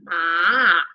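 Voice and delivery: "ma" drawn out, dipping low then rising; Mandarin third tone. Shown as a demonstration. Teacher showing the third tone, dip and rise. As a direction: let "ma" sink and climb back up slowly. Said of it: "un tono que baja y vuelve a subir".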